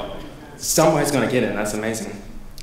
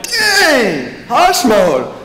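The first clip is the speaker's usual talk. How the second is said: amazed; 8 semitones higher, much slower than usual. Retold or imitated. Amazed reaction.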